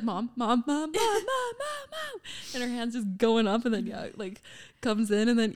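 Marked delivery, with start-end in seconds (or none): 0.0-2.2 rising panicked calls for mom; mock frantic cries getting higher; panicked calling. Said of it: "she's like".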